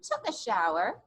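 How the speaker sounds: put-on slow, paced read-aloud for little kids; storybook reading. A narrator's reading. Teacher reading a picture book to kids, gentle storytelling. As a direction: read each line like a page turn.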